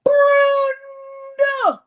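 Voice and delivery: high loud drawn-out call; long, loud, high name call; shouted name. Acted. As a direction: belt the name out as if calling across a house. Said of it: "say her name like that".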